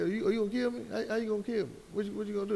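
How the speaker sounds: lightly mimicked taunt, treating the threat as a joke; mocking disbelief. Officer scoffing at a handcuffed man's threat, mocking. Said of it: "it was a joke to him".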